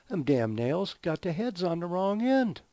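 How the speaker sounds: mock-aggrieved workman griping upward; indignant worker. Worker explaining wasted nails to foreman, indignant. Acted worker's complaint in joke.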